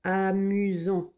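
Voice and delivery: Amusant read slowly; slow dictation. Shown as a demonstration. Teacher dictating Amusant slowly. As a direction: draw out Amusant. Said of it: "dictation read slowly".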